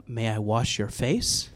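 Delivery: humble, hushed namaste politeness; mock reverent request. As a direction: ask it like a serene spiritual helper. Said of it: "very respectful in this ... namaste kind of way".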